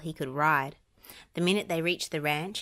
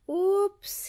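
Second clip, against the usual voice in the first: chuckling; 4 dB louder than usual. A narrator's reading. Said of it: "he said with a chuckle".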